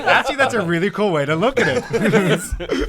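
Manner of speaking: laughing through the quote, high and loud; amused, laughing while repeating it. Retold or imitated. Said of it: "I'm like".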